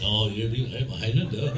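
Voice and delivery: low mock-Scottish Highlander; proper Highlander accent. Highlander correcting his wrong accent, low Scottish accent. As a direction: drop low into a put-on Scots accent. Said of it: "I should be speaking with that accent".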